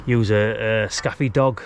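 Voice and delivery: usual speaking voice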